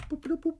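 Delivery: high-pitched little machine boops; soft, very high beeping-machine noise; machine boop imitation. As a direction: boop like a tiny gadget.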